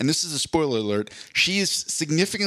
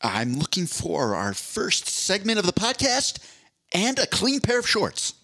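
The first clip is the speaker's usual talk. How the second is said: Jim Carrey quote; 6 semitones higher than usual. Retold or imitated. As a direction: deliver it like a goofy detective. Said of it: "That was one of his quotes".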